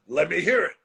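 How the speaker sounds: imitating Vince. Vince asking to hear.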